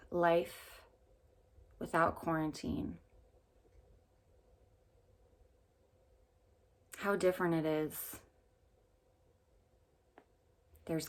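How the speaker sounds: calm meditation guide with long pauses; unhurried phrases, leaving room to breathe between them; guided meditation. Real, not acted.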